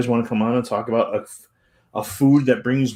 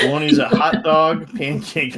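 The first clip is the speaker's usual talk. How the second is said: loud, higher, through laughter; laughing read-out; 4 semitones higher, 7 dB louder than usual. Real, not acted. Reading a silly post to co-host, laughing loudly. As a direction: read it out while cracking up.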